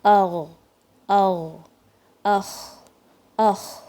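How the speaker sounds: flowing ugh and ukh; ghain and kha. Shown as a demonstration. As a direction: let the air scrape gently, no stress. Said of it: "غین اور خا".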